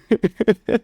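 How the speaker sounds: high helpless giggle; cracked-up tittering; laugh. Real, not acted. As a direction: giggle at your own blunder. Giggles at own mistake.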